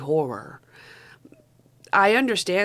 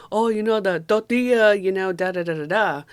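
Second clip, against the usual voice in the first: imitating people who overstress tortilla; mock over-emphasis; 2 semitones higher, 4 dB louder than usual. Mocking people who stress foreign words, with a hammed-up stressed "tortilla". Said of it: "put emphasis on non-English words".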